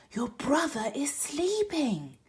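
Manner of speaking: put-on lively picture book reading for kids; storybook read-aloud voice; story narration. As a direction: read it to children with bounce.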